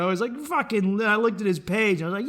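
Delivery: usual speaking voice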